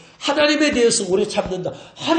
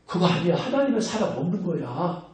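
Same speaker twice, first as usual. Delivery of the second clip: ordinary talk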